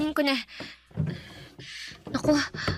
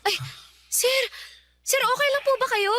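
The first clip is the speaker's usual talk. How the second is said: alarmed concern; 10 semitones higher than usual. Acted. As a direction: voice jumps up high, worried. Maid alarmed as master stumbles.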